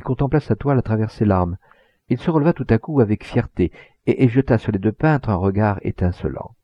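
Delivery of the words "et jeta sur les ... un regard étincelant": usual speaking voice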